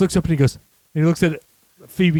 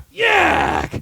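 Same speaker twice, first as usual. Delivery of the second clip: high shouted mock disgust; imitated yuck cry. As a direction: shriek it like a revolted character. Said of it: "he goes".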